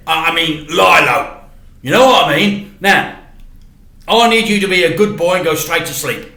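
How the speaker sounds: firm and hurried, wanting him asleep now; stern dad. Acted. Dad orders son to bed.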